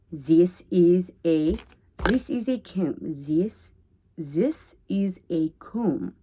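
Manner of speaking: slow, deliberate Russian accent with "this" as "zis". Shown as a demonstration. Demonstrating how you get the Russian, Russian accent. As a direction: spell it out slowly in a Russian accent. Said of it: "That's how you get the Russian".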